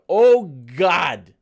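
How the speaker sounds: loud startled yell at the screen; shocked shout. Real, not acted. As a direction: cry out in real shock. Sudden sight of old video.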